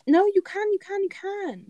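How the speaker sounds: high, loud insistence tailing off in volume; excitedly overriding an objection; insistent. Real, not acted. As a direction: pitch it right up, insisting. Insisting against the other's objection.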